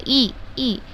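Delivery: ordinary talk, nothing marked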